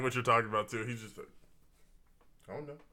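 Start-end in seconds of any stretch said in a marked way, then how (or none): 2.5-2.8 high, soft mock-innocent voice; playing dumb. Mimicking a friend who is hiding a secret, mock innocent. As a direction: feign ignorance with a small rising lilt.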